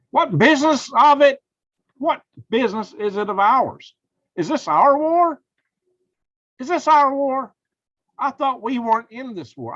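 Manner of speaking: high-pitched, incredulous outrage; indignant disbelief. Real, not acted. Outraged reaction to Kirby's ceasefire statement.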